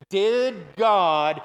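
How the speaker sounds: very slow, word-by-word preacher's question; say-along question. Shown as a demonstration. Preacher leads the congregation in the serpent's question, slow and drawn out. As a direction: stretch each word so the room can join in. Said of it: "come on, say that with me".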